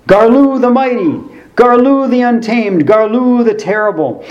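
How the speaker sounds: high, booming monster-movie trailer announcer; TV commercial announcer. Acted. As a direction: proclaim each title like a B-movie ad. Mimicking monster toy commercial titles.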